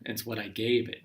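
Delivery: normal talking voice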